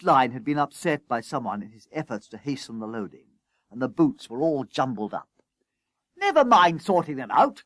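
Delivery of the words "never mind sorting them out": high, loud impatient order; harassed, raised voice; calling out. A narrator's reading. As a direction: call across to hurry him. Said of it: "called Coupling".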